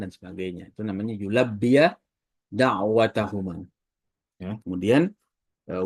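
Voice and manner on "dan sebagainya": ordinary delivery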